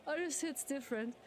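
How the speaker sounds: usual speaking voice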